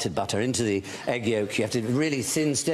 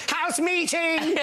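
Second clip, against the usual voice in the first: put-on high, slow, stretched-out yell like Rick from The Young Ones; shouting like Rick; much slower than usual. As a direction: bellow it like a student summoning housemates. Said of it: "I shouted, a bit like Rick in".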